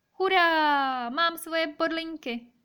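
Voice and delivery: high, loud cheer from a little hedgehog; rejoicing hedgehog. A narrator's reading. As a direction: cheer with a long drawn-out hurrah. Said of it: "radoval se Ježiček".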